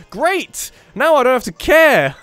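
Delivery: high, loud mock delight; sarcastic cheer. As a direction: cheer it out with ironic glee. Mock cheer at game news.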